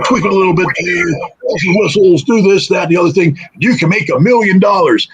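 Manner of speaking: butter him up like a Hollywood smooth talker; slick talent scout. Retold or imitated. Talent scout flattering the podcaster, slick high pitch. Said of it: "And this guy's slick".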